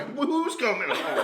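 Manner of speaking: high, slow baffled question; confused. Retold or imitated. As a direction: ask in disbelief. Confused reply, laughing.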